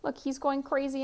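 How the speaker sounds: plain reading voice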